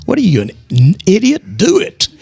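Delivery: lightly acted, high-pitched scolding; mock exasperated God. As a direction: imitate an exasperated voice, pitch jumping up. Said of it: "I had a little prayer with God".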